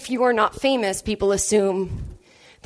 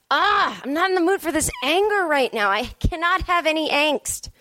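higher-pitched, lightly hammed-up complaint; mock exasperation; 6 semitones higher than usual. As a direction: act out being fed up, pitch lifted. Retold exasperation at loud angry song.

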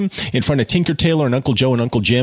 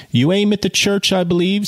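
higher, lightly voiced uncle; Uncle Joe voice; 9 semitones higher than usual. A narrator's reading. Narrator voicing Uncle Joe to Jude, lightly higher. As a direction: read the uncle a touch higher. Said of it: "said Uncle Joe".

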